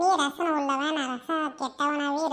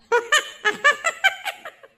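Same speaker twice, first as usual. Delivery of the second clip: high, loud burst of cackling; mocking laughter; 12 semitones higher, 6 dB louder than usual. Friend caught faking a call to mother, mocking laughter. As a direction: laugh hard at a friend's humiliation.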